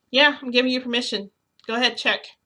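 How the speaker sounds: insistent, challenging permission; defiant dare. Retold or imitated. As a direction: egg them on boldly. Keeps daring him in retold scene.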